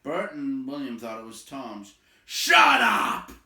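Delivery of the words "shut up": loud, high shouted order; angry troll shout. A narrator's reading. Trolls shouting at Tom to stop, loud. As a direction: bark it at him. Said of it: "said they".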